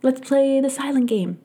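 put-on sing-song trick to hush little kids; grown-up to kids. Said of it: "and then you go".

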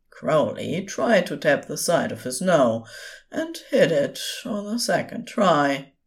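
usual speaking voice

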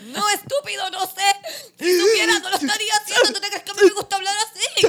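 high, wailing mock sob; mock crying. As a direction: ham up a tearful breakdown. Mock tearful rant.

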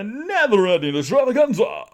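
high, loud, grandiose flourish; mock announcer. Hyping the weekend of chores, grand mock announcement. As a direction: proclaim it like a big show, voice swelling.

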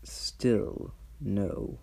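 immovable archdevil, flatly refusing; dragon voice. Acted. Mammon refuses the haggling.

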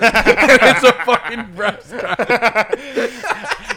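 breaking into laughter, slow and loud; cracking up through the words; laughing punchline. Real, not acted. Laughing at his own joke about the wrestler's wife, loud and slow.

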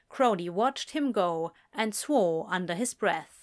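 plain reading voice

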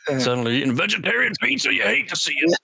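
getting worked up over the pepperoni plot; mock conspiracy rant. Joking about pepperoni conspiracy, rising mock outrage.

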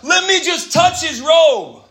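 loud, high, drawn-out plea; desperate plea. Acted. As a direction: cry out slowly in desperation. Acting out desperate plea for healing.

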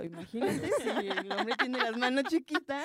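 slow, laughing through the words; giggling as she pictures it. Real, not acted. Friend laughing while joking about small hands.

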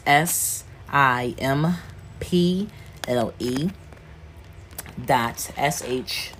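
slow, letter-by-letter spelling. Real, not acted. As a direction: spell it slowly with gaps between letters. Spelling out the web address.

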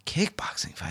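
a soft, breathy whisper of a puzzled question. Retold or imitated. Retelling the bouncer's reply to the club owner, whispered. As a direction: whisper it, confused. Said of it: "And he goes".

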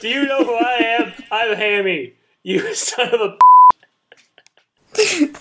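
high, laughing arrogant pig ruler; a pig lording it over the street; haughty pig king. Pretend.

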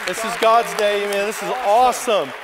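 loud, high, fired-up preacher calling to the crowd; preaching at full force. Acted. Pastor rousing the Easter congregation to shout, booming. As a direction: whip the church into cheering.

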